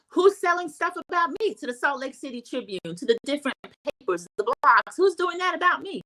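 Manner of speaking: mock yell, pitched high; retold yelling. Lisa accusing the women on the yacht, mock yelling. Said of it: "Lisa starts yelling".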